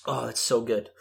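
usual speaking voice

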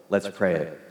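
usual speaking voice

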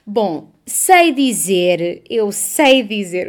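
put-on loud, very drawn-out old-lady catchphrase; César Mourão's old woman. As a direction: imitate an old woman stretching her words. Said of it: "diz muito isto quando imita velhas". (velha a falar alto e arrastado)

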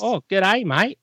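cheery Australian impression; Australian accent. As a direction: say g'day like a bloke.

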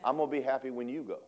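ordinary delivery

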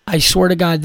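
ordinary talk, nothing marked

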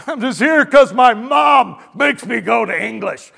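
loud, high student complaint; reluctant high-schooler. Acted. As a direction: put on a grumbling schoolboy's voice. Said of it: "guys in English class in high school that just didn't want".

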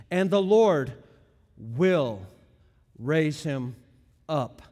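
pause, then "will" set down low and firm; emphatic. Shown as a demonstration. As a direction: isolate "will", then "raise him" and "up". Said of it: "That's emphatic language".

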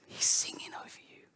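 soft unvoiced whisper full of warmth; tender whisper. Shown as a demonstration. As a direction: whisper it like a loving father. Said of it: "with warmth in his eyes".

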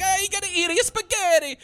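high-pitched, hammed-up Brooklyn Italian accent; mock Brooklyn accent. Podcaster mocking the movie's Italian family, exaggerated Brooklyn accent. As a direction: ham up a Brooklyn family squabble. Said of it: "he's doing the Brooklyn accent".

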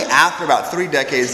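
ordinary talk

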